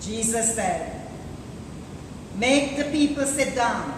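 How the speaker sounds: ordinary talk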